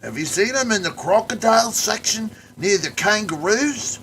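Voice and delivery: high, loud hammed-up Australian accent; Australian caricature. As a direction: play a cartoon Aussie wrestler. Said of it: "they sadly had to play it like a caricature".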